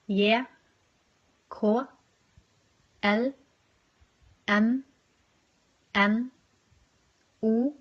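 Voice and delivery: each letter from "A" to "Å" named one by one the Norwegian way, a pause after each; Norwegian letter names. Shown as a demonstration. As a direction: recite the alphabet letter by letter in Norwegian, "Æ, Ø, Å" at the end. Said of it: "quickly go through the names of the letters".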